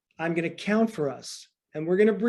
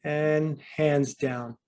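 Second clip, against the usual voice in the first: guided breathing count; 4 semitones lower, much slower than usual. Acted. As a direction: count the breaths slowly to calm them. Coach counts breathing for class.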